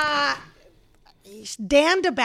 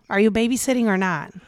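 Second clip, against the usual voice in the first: normal talking voice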